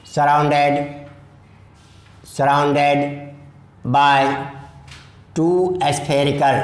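slow, word-by-word dictation to students. Shown as a demonstration. Teacher dictating notes to class, slow and deliberate. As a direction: spell it out slowly so they can write.

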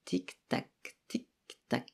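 soft, breathy tick-tock of a clock. A narrator's reading. The big clock ticking. (tic-tac doux et chuchoté de l'horloge)

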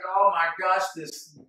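exasperated. Retold or imitated. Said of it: "and you're just like".

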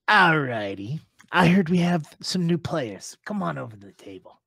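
expansive table host, big opening then easing off; welcoming boss. Acted. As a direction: boss holding court. Game master plays boss at table.

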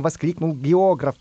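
usual speaking voice

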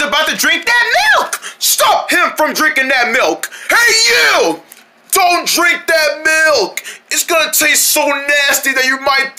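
slow-motion shout. Acted. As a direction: drag the shout out slowly. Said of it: "Why am I talking in slow motion".

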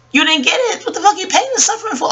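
high, fast laughing outrage; scoffing at the pain and suffering claim, high; incredulous laugh. Real, not acted. Mocking the lawsuit claim.

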